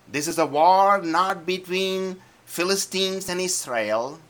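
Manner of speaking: high, loud preacher's cry; preacher driving home the point; fiery preaching. Real, not acted. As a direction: preach it to the whole church. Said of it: "preaching climax".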